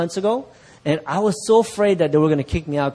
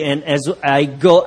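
ordinary talk

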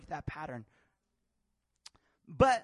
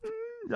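high, thin imitated whimper; put-on tearful hum; mock crying whimper; 5 dB quieter than usual. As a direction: whimper like a dad tearing up. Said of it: "he started crying one night".

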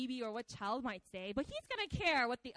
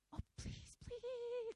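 whispered, desperate begging; desperate plea; 9 semitones higher, 7 dB quieter, much slower than usual. Shown as a demonstration. Desperate Saul begs Samuel in a whisper. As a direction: beg in a breathless whisper. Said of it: "it's so desperate".

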